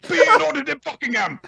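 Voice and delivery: high, hammed-up British accent; mock posh British call. Joining the joke, summoning dragons in silly British accent. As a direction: sing out like a jolly posh Brit. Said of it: "to command your dragon".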